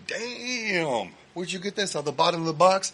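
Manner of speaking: lightly voiced rapper marvelling at the old CD; impressed [name]. [name] eyeing the fan's CD, mildly amazed.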